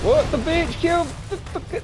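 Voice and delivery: high shocked shout; outraged disbelief. Real, not acted. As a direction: yell it in disbelief. Killed in game.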